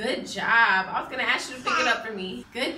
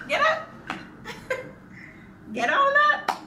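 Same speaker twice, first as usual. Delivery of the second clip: high, excited encouragement; 13 semitones higher than usual. Real, not acted. Mom cheering her baby to stand, high and excited. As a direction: cheer him up onto his feet.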